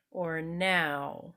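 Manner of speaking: "now" opened wide, then the lips narrowing; ow sound demo. Shown as a demonstration. Teacher models "now" for students, lips exaggerated. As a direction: start wide on "now" and close to w. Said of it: "I start wide and then I narrow down".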